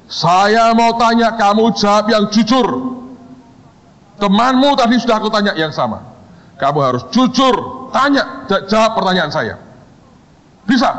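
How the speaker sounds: the boss grilling a shaking student; stern founder. Retold or imitated. Founder interrogates second student.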